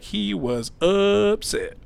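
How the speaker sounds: slow, stretched imitation of the doctor; drawn-out quote. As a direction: draw the words out for comic emphasis. Quoting the doctor with drawn-out words.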